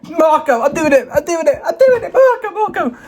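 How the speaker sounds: high-pitched, thrilled self-hype; excited. Real, not acted. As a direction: psych yourself up to commit to the pick. Hyping himself into a bold pick.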